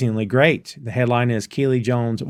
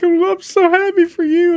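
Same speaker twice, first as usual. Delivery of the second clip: high-pitched, louder hammed-up happy weeping; mock bawling; 5 dB louder than usual. Friend imitates Keely congratulated through tears, mock bawling. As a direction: wail it like a comic happy cry. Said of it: "and they are bawling".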